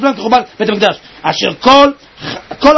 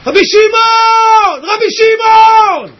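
high, long-drawn shout to Rabbi Shimon; זעקה; 8 semitones higher, 3 dB louder, much slower than usual. Shown as a demonstration. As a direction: cry out with full heart, stretching the name. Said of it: "הוא ככה קול כזה של מנחי רדיו". (זעקה גבוהה וממושכת)

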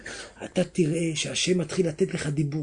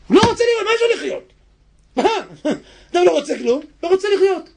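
much higher and louder peak; impassioned preaching; 11 semitones higher, 5 dB louder, faster than usual. Acted. Preacher warns listeners about losing will, impassioned. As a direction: throw it out with passion.

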